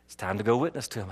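low, soft, quick prompting; quiet prodding. Retold or imitated. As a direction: voice the Spirit's hushed reminder. Holy Spirit prompts him once more.

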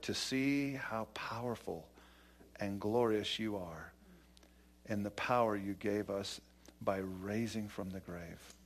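soft, reverent pastoral prayer. Acted. Pastor prays before preaching, quiet and reverent. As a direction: pray gently over the congregation.

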